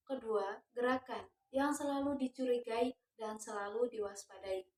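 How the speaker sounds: ordinary delivery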